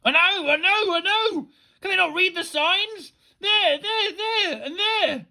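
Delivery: high-pitched frantic ranting; manic. A narrator's reading. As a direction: rant wildly, voice shooting way up. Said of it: "The teacher began manically".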